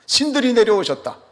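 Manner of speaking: high-pitched mock cry of astonishment; acted crowd shout. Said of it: "소리를 지르는 거예요".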